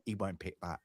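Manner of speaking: a quiet warning voice in the back of the head; inner voice. Retold or imitated. Recalling the thought in the back of their head, hushed. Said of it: "in my back of my head, it went".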